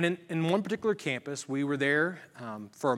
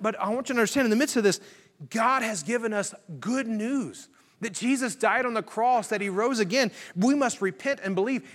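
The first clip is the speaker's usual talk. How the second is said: higher-pitched, emphatic gospel proclamation; fervent preaching; 7 semitones higher than usual. Acted. Pastor sharing the gospel with a student, preached fervently. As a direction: proclaim the good news with rising urgency.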